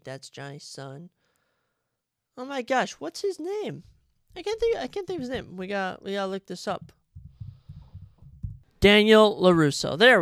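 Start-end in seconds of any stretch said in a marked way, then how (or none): 2.4-5.6 frustrated. Real, not acted. Struggling to recall name. 8.8-10.2 triumphant. Real, not acted. Remembering the name.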